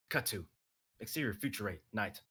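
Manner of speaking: ordinary delivery, nothing marked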